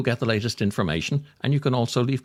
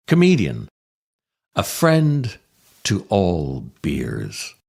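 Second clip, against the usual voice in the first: solemn eulogy. Acted. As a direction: read it like a mock-solemn eulogy at the graveside. Mock eulogy read over music.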